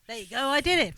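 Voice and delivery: high, loud acted shout; mock shout. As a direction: call it out, imitating a triumphant captive. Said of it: "And Lawrence shouts".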